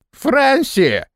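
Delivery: high tipsy exclamation; exclaiming, slurred. A narrator's reading. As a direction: call her name out, a bit drunk. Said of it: "воскликнул Флаппи слегка заплетающимся языком".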